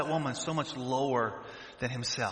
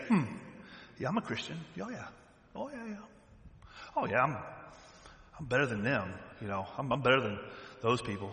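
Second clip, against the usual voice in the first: smug self-satisfied; 6 semitones lower, 10 dB quieter, faster than usual. Retold or imitated.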